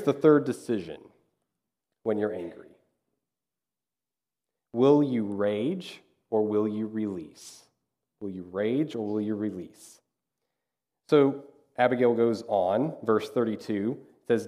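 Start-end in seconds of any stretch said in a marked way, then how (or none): 4.7-7.6 drawn-out question, leaving room to weigh both choices; slow and deliberate. Shown as a demonstration. Preacher posing the third decision to the congregation, slow and deliberate. Key question, posed then repeated. 8.2-10.0 soft, quicker restatement of the choice; softer repeat. Shown as a demonstration. Preacher repeating the choice to the congregation, softly.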